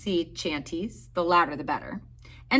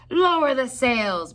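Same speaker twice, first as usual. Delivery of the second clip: loud, higher, slow order; captain ordering the crew in a storm; captain shout; 6 semitones higher, 6 dB louder, slower than usual. A narrator's reading. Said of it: "Braid Beard shouted".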